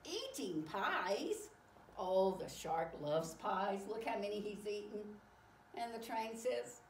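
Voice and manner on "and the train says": ordinary delivery, nothing marked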